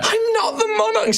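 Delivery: high, tearful denial; nearly in tears; on the verge of crying. Acted. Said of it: "on the verge of crying".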